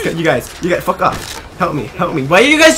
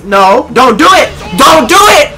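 louder, higher warning yell; commanding shout; 5 semitones higher, 7 dB louder than usual. Real, not acted. Gamer warning an enemy off the stairs, loud commanding yell. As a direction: order him to turn around. Yelling at enemy to turn back.

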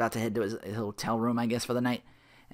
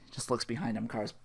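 ordinary talk

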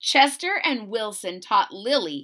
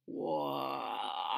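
much lower and softer awed whoa; 8 semitones lower, 12 dB quieter than usual. Acted. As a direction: breathe out in wonder. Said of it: "said Wilson".

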